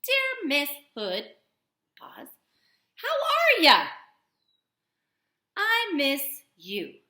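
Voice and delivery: slow read, "pause" whispered, "how are ya?" loud and high. Shown as a demonstration. Teacher reads the letter aloud to class, slow with pauses. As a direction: read it slow, pausing at the comma. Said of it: "Let's read what I've got".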